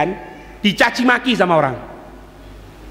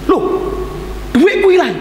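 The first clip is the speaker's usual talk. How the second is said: alarmed accuser; 7 semitones higher than usual. Retold or imitated. Man accusing the praying Imam, mock alarmed cry. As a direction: raise a mock alarm over lost money. Accuser voiced crying money lost.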